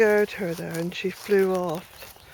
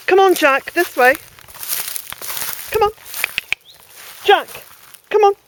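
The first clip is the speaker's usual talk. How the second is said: loud, much higher call to the dog; 10 semitones higher, 10 dB louder than usual. Real, not acted. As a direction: call him over across the garden. Calling dog to follow.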